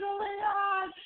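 high-pitched dismay. Real, not acted. Dismay at the scene.

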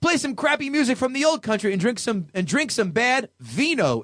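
high, exaggerated sneering read; mock offended reviewer. Host quoting an angry reviewer, sneering. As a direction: sneer through the reviewer's rant. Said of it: "This is how I imagine".

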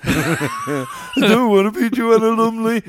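high, loud, slow whining mate; a reluctant friend cast as Joanna Lumley whines in a loud high protest; whiny protest. Retold or imitated. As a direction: whine it like a sulking kid. Mate whining about role.

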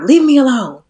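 high, loud 'leave me alone' outburst; loud brush-off. Shown as a demonstration. Showing how not to talk to her sister, loud. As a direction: push them away loudly. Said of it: "It's not being loud. It's not".

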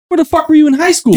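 mock disbelief. Friend ribs actor about his sudden career, mock disbelief. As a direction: tease with a baffled question.

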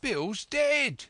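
very high, loud cry of surprise; high-pitched startled yell; shouting from indoors. A narrator's reading. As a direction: shout the news out from the house. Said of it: "then a voice shouting from the house".

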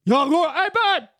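hammed-up gym guy heading out; gym bro voice. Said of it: "the guys that go to the gym".

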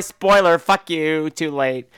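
ordinary talk

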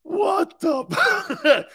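high, loud "What the?" in laughing shock. Real, not acted. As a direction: yelp it out, caught off guard.

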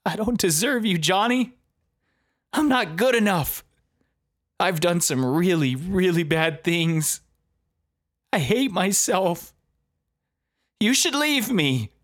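a touch of sobbing self-blame; weeping. Retold or imitated. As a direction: plead through mock tears, pitched high. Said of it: "She began weeping".

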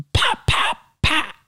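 breathy whispered pop pop pop; whispered gunshot pops. Retold or imitated. Actor imitating firing blanks, whispered pops. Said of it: "I go".

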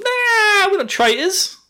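high, strained mock denial; pretending innocence to the guards; squeaky denial. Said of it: "and gone".

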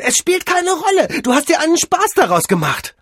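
loud, very high outburst; furious accusation. Acted. Mavis rejects his excuse. (laut und wütend anklagend)

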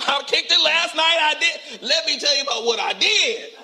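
high-pitched, eager boasting; excited street reveler. Retold or imitated. Preacher acting out a street partier to the congregation, excited. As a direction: play someone bragging excitedly about last night. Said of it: "When you run up to a person".

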